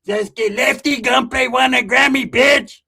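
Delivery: high, loud, slow taunting homeboy. Retold or imitated. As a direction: drag it out like a cocky vato. Cousin voiced in story.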